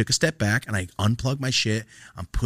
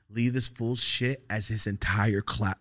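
usual speaking voice

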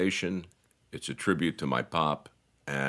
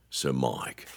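Australian DJ; 4 semitones lower than usual. Retold or imitated. Imitating an Australian radio host, low Australian accent. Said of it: "What you hear in Melbourne is".

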